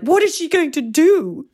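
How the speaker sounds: high, loud worried question; anxious reader voice. Retold or imitated. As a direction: fret about what she'll do next. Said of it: "So I'm like".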